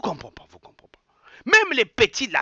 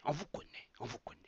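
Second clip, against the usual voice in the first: much softer, almost under the breath; fading repetition; 3 semitones lower, 19 dB quieter than usual. Real, not acted. Repeating to the old leaders that he knows them, fading to a murmur. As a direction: let it die away knowingly.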